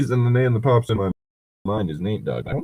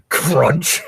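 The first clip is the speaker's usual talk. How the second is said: mock bone-crunch; 6 semitones higher, 4 dB louder than usual. Mimics a neck breaking.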